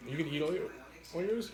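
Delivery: ordinary delivery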